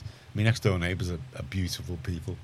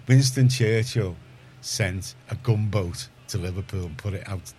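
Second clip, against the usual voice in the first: ordinary talk, nothing marked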